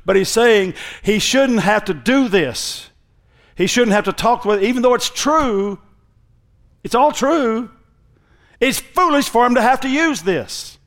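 high, emphatic sermon delivery; impassioned preacher pressing the argument; forceful preaching. Real, not acted. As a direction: build in pitch as you drive the point home.

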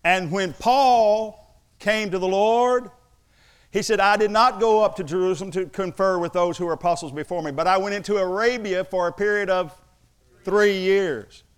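forceful preacher building to a landed final phrase; preaching. Real, not acted. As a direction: proclaim it from the pulpit. Preaches from Paul's account.

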